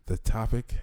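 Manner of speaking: ordinary talk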